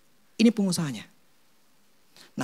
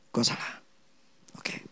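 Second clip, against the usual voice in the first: breathy whisper, barely there; whispered self-blame; whispered admission; 13 dB quieter than usual. Retold or imitated. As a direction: whisper it like a private confession. Repeats the realization.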